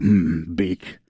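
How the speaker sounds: low, musing hum and trailing words; understated, unimpressed acknowledgement; quizzical, dismissive. Acted. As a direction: muse quietly, then let it drop. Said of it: "disregards you quizzically".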